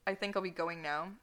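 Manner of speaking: ordinary talk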